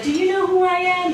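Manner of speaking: high haughty demand; mock diva. Comic to the butcher, high haughty demand. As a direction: play the entitled customer. Self-important demand at counter.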